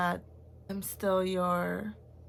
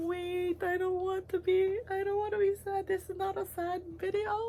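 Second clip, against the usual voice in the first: tearful voice pitched much higher; real crying; 12 semitones higher than usual. Real, not acted. Aunt crying on camera for her nieces and nephew, tearful and high. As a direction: fight the tears, voice climbing high. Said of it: "I'm gonna cry".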